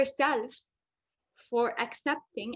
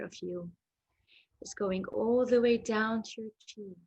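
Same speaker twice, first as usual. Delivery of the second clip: hypnotherapy voice. Acted. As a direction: lull them into calm, drifting into whispers. Leads a hypnotherapy relaxation.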